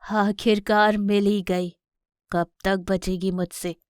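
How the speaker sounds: lightly voiced villain lion, slow taunt; menacing villain lion. Villain lion corners the mother. (हल्की खलनायकी, धमकाती आवाज़)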